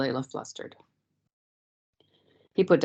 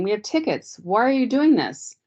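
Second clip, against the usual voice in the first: higher pitched, rising panic; frightened plea; 7 semitones higher than usual. A narrator's reading. Layla protests to the guard leading her away, panicked. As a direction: push back at the guard, voice climbing. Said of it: "her voice was shaking".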